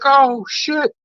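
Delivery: high, excited exclamation; high-pitched surprised buddy; surprised bar friend. Retold or imitated. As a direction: squeal it out like a startled friend. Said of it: "He was like".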